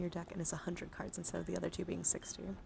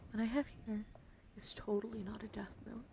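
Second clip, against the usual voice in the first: usual speaking voice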